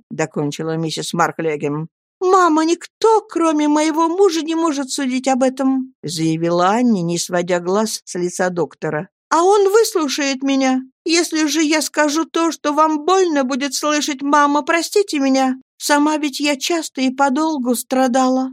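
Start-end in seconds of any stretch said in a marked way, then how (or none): 2.2-5.9 put-on a higher, earnest young wife's voice; Annie pleading. Young wife begging her friends to speak, pleading. 9.3-18.5 put-on a higher, earnest young wife's voice; Annie pleading. Young wife begging her friends to speak, pleading.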